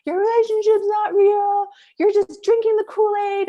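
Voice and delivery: role-play tirade, high and loud; playing her angry parents. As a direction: attack her like a furious parent would. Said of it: "totally lost in the role".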